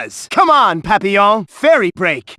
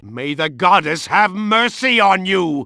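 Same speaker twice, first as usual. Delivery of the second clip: normal talking voice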